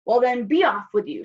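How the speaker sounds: loud troll dismissal; troll's roar. A narrator's reading. Troll dismissing the little goat, loud roaring voice. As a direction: send them off loudly as the troll. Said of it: "roared the troll".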